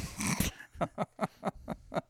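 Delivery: breathy unvoiced laugh at the double meaning; real laugh. Real, not acted. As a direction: let a real laugh slip out. Laughs at slide one in joke.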